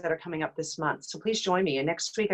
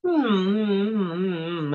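chewing hum. Shown as a demonstration. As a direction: hum while chewing the face loose. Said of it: "imagine I'm chewing off the front of my face".

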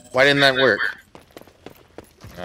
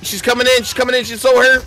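high, urgent excited callout; 14 semitones higher than usual. Real, not acted. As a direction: call it out in a rush. Excited callout of enemy approaching.

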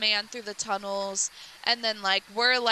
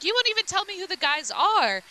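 high-pitched acted protest; indignant challenge; 8 semitones higher than usual. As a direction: throw it back, mock indignant. Retold argument with doctor.

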